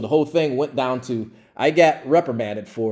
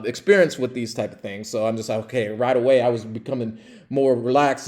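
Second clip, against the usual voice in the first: ordinary talk, nothing marked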